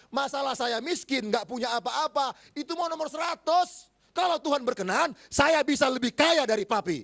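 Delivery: loud, high rousing proclamation; preaching at full force. Acted. Preacher closing the son's testimony to the church, rousing and loud. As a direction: proclaim it as a triumphant truth.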